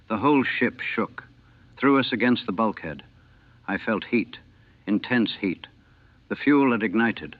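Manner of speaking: ordinary delivery, nothing marked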